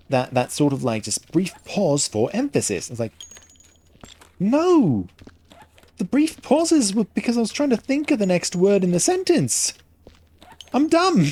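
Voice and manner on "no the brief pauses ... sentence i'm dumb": high-pitched, loud mock-indignant retort; exasperated protest. Defending himself against being told he adds emphasis, exasperated protest. Retelling his protest at the accusation.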